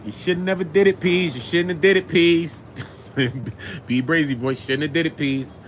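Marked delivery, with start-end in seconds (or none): 0.0-2.6 mock scolding.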